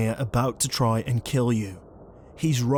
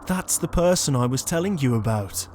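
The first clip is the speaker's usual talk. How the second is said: normal talking voice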